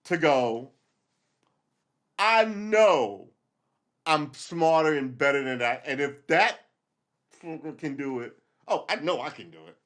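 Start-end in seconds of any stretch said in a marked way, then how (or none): 2.2-9.8 high, loud cocky boast; a cocky vow made to yourself; arrogant boast. Retold or imitated. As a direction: puff up your ego. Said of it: "The arrogance of my ego to go".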